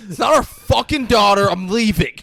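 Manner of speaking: loud, high indignant rant; outraged shouting. Retold or imitated. Older man protesting to everyone as he leaves, outraged shout. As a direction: shout it while storming out.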